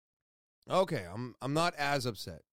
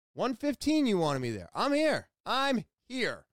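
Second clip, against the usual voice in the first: higher-pitched exasperated insistence; indignant; 7 semitones higher than usual. Retold or imitated. Patient insisting on being on time for the appointment, indignant. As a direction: put out, punching each short phrase. Retold outburst at being turned away.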